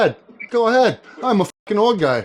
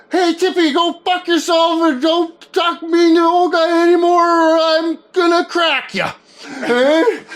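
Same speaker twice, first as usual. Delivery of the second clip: mock threatening shout; 11 semitones higher than usual. Message for Uncle Steve, a hammed-up high yell at Tippy. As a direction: bark a mock threat, half laughing. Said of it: "this is a message for Uncle Steve".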